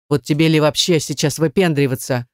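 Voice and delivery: ordinary delivery, nothing marked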